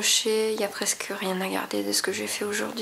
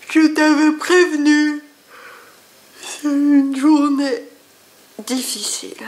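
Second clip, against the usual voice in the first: mock scolding; 6 semitones higher, 12 dB louder than usual. Scolding voice to the cat.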